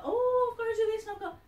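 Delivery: high-pitched mock fretting; mock overburdened. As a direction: act out someone swamped by chores. Acts out feeling overburdened.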